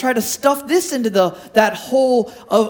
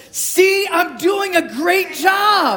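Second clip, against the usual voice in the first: triumphant; 9 semitones higher, slower than usual. Retold or imitated. Punchline of fan story.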